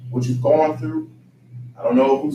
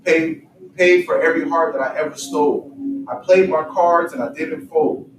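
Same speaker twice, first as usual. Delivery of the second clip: recited lyrics; 4 semitones higher, 4 dB louder than usual. Acted. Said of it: "He says".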